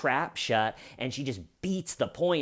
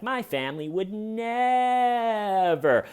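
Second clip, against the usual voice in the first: much higher, slow put-on voice on "never"; mock high Wendy; 9 semitones higher, slower than usual. Parody of Wendy in a spliced video, high slow denial. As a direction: squeak out a denial. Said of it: "someone could make a really funny video".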